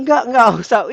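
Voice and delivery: high, loud imitated protest; retold insistent refusal. Preacher retells refusing a woman's blessing, insistent mock refusal. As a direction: wave the gift away emphatically.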